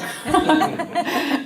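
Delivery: high burst of laughter; real laugh. Real, not acted. Speaker amused by own Canadian quip, laughing. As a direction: laugh at your own aside.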